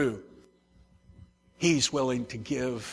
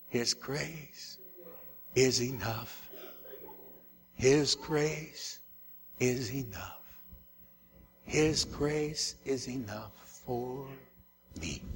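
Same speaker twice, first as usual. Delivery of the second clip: soft refrain; 7 dB quieter, slower than usual. Acted.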